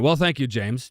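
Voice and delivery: usual speaking voice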